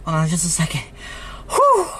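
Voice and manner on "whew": relieved whew. Real, not acted. Relief after excitement.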